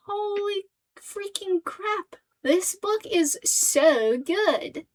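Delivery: high, very drawn-out amazement; slow, elevated retold astonishment; mock awe. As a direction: stretch it out in hammed-up wonder. Retelling own amazed reaction.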